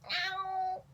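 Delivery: strange meow that surprises her; odd new meow. Shown as a demonstration. Trying again and hitting a weird new cat voice. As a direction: crack out an unexpected meow. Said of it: "New voice unlocked? What the heck was that?".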